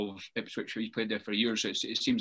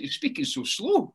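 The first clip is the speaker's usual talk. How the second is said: high, slow imitation of dad; mock dad; 9 semitones higher than usual. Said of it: "He's like".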